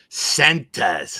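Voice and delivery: put-on high and loud old lady "Centers".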